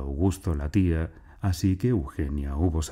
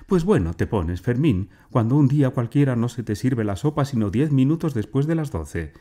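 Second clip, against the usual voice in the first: brisk higher-pitched wifely reproach; scolding interruption; 7 semitones higher, 4 dB louder than usual. A narrator's reading. Wife mocking husband's ideals over late soup, scolding. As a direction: cut in and puncture his lofty talk.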